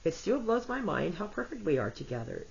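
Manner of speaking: usual speaking voice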